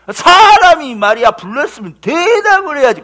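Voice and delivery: loud, high, stretched-out scolding; acted-out thug's telling-off; bullying reproach. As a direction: berate someone who ignored you. Robber grabs collar and berates pastor.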